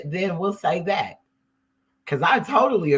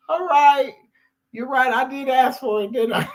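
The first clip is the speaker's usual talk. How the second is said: high, giggly giving in; laughing concession; 9 semitones higher, 3 dB louder than usual. Retold or imitated. Answering her own inner voice, high and laughing. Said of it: "And I'm like".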